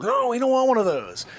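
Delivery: ordinary delivery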